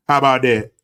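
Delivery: loud, punched "How about that?"; loud challenge. Real, not acted. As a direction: throw "How about that?" out like a dare.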